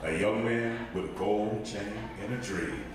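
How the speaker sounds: deep calm documentary narration; Morgan Freeman impression. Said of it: "that's my Morgan Freeman impression".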